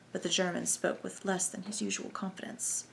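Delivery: usual speaking voice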